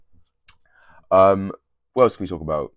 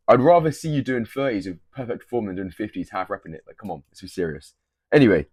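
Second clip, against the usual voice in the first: ordinary talk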